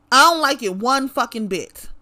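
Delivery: ordinary talk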